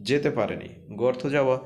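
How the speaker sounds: ordinary talk, nothing marked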